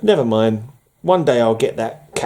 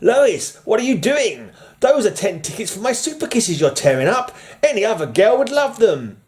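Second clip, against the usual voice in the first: high, scolding comic-book hero; hammed-up, higher-pitched protest to Lois; indignant Superman; 9 semitones higher than usual. Reads Superman's outraged cover speech.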